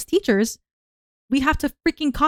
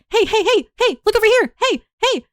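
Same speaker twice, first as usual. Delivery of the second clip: high, loud hey calls; clownish attention calling; 11 semitones higher, 4 dB louder than usual. Retold or imitated. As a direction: jingle for the kids' attention. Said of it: "dress up in a clown suit".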